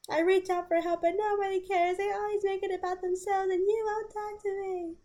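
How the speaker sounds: distraught ex. Retold or imitated. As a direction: read it like a hurt, desperate partner. Continues reading ex's message.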